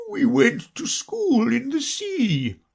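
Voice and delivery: slow, high, sobbing storyteller; Mock Turtle. A narrator's reading. Mock Turtle recalls school in the sea, tearful and slow. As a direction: recall it mournfully, drawn out and high. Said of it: "though still sobbing a little now and then".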